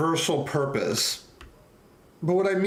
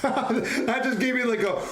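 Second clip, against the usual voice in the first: laughing; 8 semitones higher than usual. Real, not acted. Laughing, excited at an idea.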